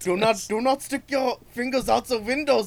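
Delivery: high, loud mock German accent; German parable voice. Reciting a German nursery-rhyme warning about fingers, in a loud mock German accent. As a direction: intone it like a stern German fairy tale. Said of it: "German parable".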